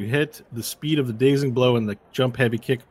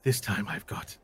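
hint of determination, thinking aloud; opponent's inner voice. Game master voices foe's thoughts to player, lightly in character. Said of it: "you hear the voice in your head again going".

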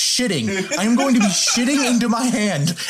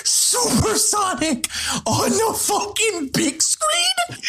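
high, slow shout of disbelief; hyped; 11 semitones higher, slower than usual. Real, not acted. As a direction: stretch it out in awe. Hyped fan exclaiming.

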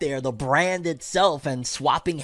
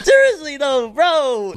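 voice cracking; 10 semitones higher, 5 dB louder, slower than usual. Real, not acted. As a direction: voice cracks up high mid-word. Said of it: "My, my voice just cracked".

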